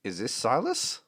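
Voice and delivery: outraged sailor who can't believe his eyes; indignant gruff NPC. Acted. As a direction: play the scandalised crewman. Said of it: "looks down at the child and goes".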